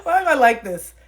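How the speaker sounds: laughing. Real, not acted. Laughing at herself.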